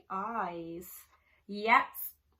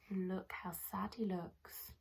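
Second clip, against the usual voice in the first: whisper; 3 semitones lower, 7 dB quieter than usual. Real, not acted. Reader's sad aside to children.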